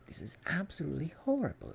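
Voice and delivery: fast, high, loud outburst of revulsion; exclaiming in disgust. A narrator's reading. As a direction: blurt it out appalled. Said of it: "exclaimed Arthur".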